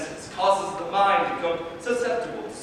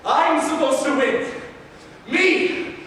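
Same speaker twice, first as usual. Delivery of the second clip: higher, rising panicked denial; desperate disbelief; 6 semitones higher than usual. Acted. Dying Piero denying his defeat to Reyna, desperate. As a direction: refuse to accept losing.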